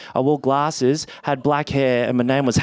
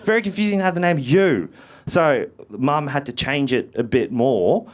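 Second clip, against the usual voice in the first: ordinary talk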